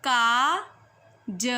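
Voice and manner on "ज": normal talking voice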